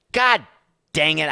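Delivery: high, loud burst of frustration; exasperated outburst. Real, not acted. Host losing the thread on air, exasperated yell. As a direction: blow up in exasperation.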